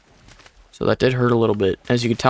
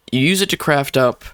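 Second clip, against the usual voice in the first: ordinary delivery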